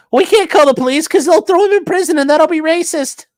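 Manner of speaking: high, sneering mock reasoning; sarcastic imitation of a naive onlooker; mocking bystander voice. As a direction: parody the bystanders' excuse.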